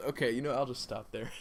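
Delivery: usual speaking voice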